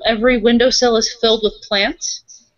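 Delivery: normal talking voice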